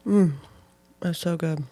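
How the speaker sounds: chewing while talking; mouth full. Real, not acted. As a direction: talk around a bite of food. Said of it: "I hope you guys can't hear me chewing".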